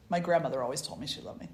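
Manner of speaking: ordinary delivery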